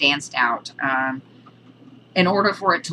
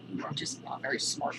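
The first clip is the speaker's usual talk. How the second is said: whispered tease; 4 semitones lower, 13 dB quieter than usual. Real, not acted. Teasing the dog.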